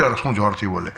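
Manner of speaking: ordinary talk, nothing marked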